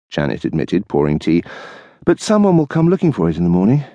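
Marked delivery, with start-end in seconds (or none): 2.0-3.8 higher, quick wife's voice. Acted. Wife pouring tea, quick practical objection. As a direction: rattle off the objection.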